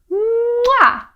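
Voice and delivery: very high, loud mwah smooch; exaggerated kiss. Shown as a demonstration. Storyteller leading children in the kiss sound, exaggerated smooch. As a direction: plant a huge cartoon kiss. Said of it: "Can you do that?".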